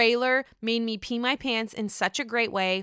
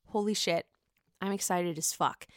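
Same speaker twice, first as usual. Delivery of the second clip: ordinary talk, nothing marked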